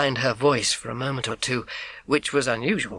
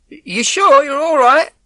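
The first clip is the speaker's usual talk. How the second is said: high, louder call through the door; milkman calling; 13 semitones higher, 10 dB louder, slower than usual. Acted. Milkman calling through the front door, loud and concerned. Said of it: "I knew his voice".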